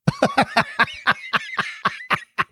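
squeaky high-pitched burst of laughter; high giggle. Real, not acted. Host reading a kid's fart question, giggling high. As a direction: crack up up high.

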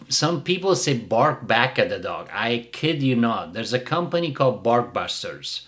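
usual speaking voice

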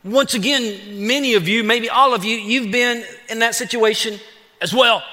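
ordinary talk

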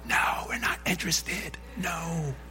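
emphatic whispered no; whispered refusal. Retold or imitated. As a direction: hiss a firm refusal under the breath. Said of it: "And Jenna's like".

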